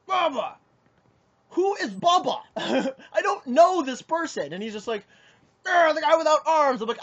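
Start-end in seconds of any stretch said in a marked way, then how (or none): 0.1-0.5 high-pitched mock holler; retold yell. Angry stranger naming his friend, imitated yell. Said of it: "comes up to me screaming and yelling". 1.5-4.5 high, loud incredulous comeback; exasperated bafflement. Retold or imitated. Player baffled by angry stranger's accusation, retold incredulous outburst. 5.7-6.8 high, loud mock holler; retold screaming. Stranger explaining who his friend is, imitated yell. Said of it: "screaming and yelling".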